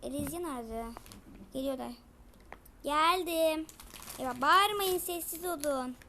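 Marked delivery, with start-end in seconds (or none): 2.8-6.0 a loud protesting shout. Acted. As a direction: yell at them to stop yelling. Child arriving, telling others off.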